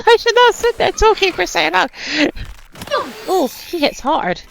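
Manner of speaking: high, loud, laughing outburst; laughing panic. Real, not acted. Player scolding self for standing there talking, laughing. Laughing at own blunder mid-fight.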